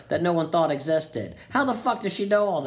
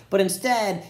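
ordinary talk, nothing marked

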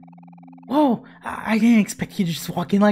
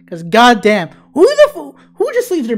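loud, much higher angry burst; angry outburst; 5 semitones higher, 11 dB louder than usual. Real, not acted. Still annoyed at the car beeper, loud outburst. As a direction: snap at the noise.

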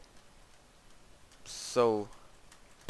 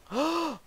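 sharp gasp. Real, not acted. Shock at map trap.

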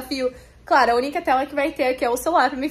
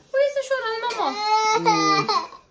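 high, wailing imitation of a crying child; mock crying; 7 semitones higher, slower than usual. Mother mimicking her crying daughter, high and whiny. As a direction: whimper it like a toddler putting on tears.

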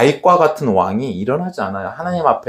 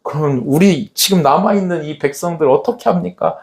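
normal talking voice

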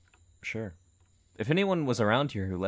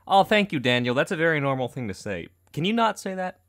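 loud, higher mock-appalled reply; alarmed sarcasm; 5 semitones higher, 6 dB louder than usual. Reply to a creepy horror-tape customer, alarmed sarcasm. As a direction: react louder and higher, playing appalled.

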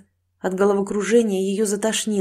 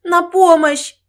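high, loud desperate shout; drawn-out, high-pitched yell for rescue; cry for help; 10 semitones higher, 8 dB louder, much slower than usual. Acted. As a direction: scream for help with all your strength. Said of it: "изо всех сил закричала".

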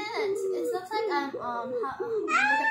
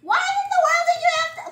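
exasperated; 13 semitones higher, 6 dB louder, faster than usual. Real, not acted. Annoyed at friend.

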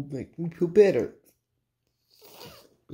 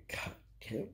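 exasperated whisper; whispered curse; 17 dB quieter than usual. Real, not acted. Vlogger fed up with himself, whispered curse. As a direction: breathe the curse out with no voice. Frustrated venting, curses under breath.

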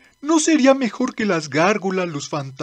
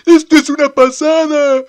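excited; 7 semitones higher, 8 dB louder than usual. Acted. Tief thrilled at the wheel.

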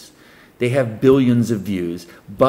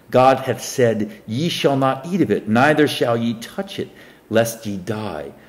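measured Bible reading voice; solemn King James recitation; scripture reading; 3 semitones higher than usual. Acted. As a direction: read the verse like a preacher at the lectern. Reads scripture aloud.

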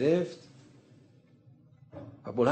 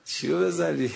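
amused question; 4 semitones higher than usual. Retold or imitated. Prophet amused.

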